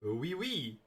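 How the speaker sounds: put-on French accent. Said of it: "That's my French accent".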